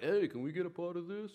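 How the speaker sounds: high, eager begging voice; comic high-pitched plea; mock tax collectors. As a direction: squeak it like a cheeky tax man.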